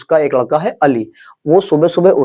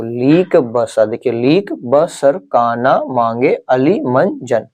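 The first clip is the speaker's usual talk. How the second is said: slow, deliberate mnemonic chant; very slow recital; much slower than usual. Shown as a demonstration. Teacher to class, drilling the mnemonic very slowly. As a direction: stretch every word of the trick. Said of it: "आपको इनको साथ में बोलना है".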